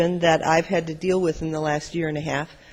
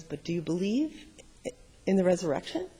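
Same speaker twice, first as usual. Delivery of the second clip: gentle question; 7 dB quieter than usual. Acted. Jesus answers the grieving sister.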